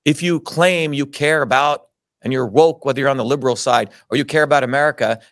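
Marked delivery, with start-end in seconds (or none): none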